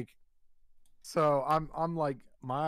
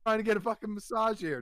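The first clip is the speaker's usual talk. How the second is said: higher-pitched exasperated telling-off; mock-indignant, pitched up; annoyed scolding; 7 semitones higher than usual. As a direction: protest to a buddy through the wall. Retold scolding of noisy buddy.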